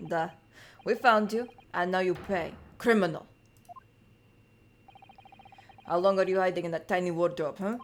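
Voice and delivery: put-on stern Russian-accented interrogator. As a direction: press the prisoner in a Russian accent. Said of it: "are you meant to be Russian too?".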